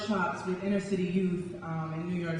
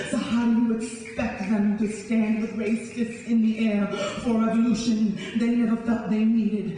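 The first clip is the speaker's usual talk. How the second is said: louder, driving spoken word build; rising passion; 6 semitones higher, 5 dB louder than usual. Acted. Poet raging at the audience, loud building spoken word. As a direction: let the anger swell line by line.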